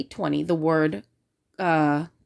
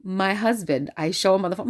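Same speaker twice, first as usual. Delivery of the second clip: usual speaking voice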